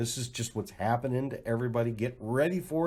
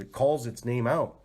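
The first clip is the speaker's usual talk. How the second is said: ordinary talk, nothing marked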